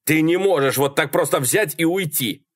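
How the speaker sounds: exasperated, urgent protest; protesting shout. A narrator's reading. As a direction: shout after someone walking off. Malcolm shouts after departing Sally.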